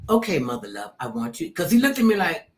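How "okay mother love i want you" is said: low-voiced imitation of the actor; James Earl Jones. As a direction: drop the pitch for his reply. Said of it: "And he goes".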